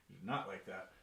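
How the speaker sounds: ordinary talk, nothing marked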